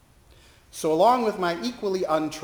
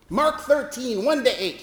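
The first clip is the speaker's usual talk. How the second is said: high, louder accusing quiz; 9 semitones higher, 4 dB louder than usual. Retold or imitated. Preacher quizzing the chaplain on Bible verses, accusing. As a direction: jab each number at her. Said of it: "he said accusingly".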